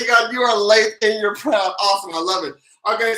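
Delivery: ordinary talk, nothing marked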